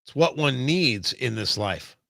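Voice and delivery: ordinary delivery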